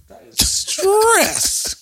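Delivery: slow stretched high exclamation; drawn out. Real, not acted. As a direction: stretch it out in comic dismay. Drawn out comic lament.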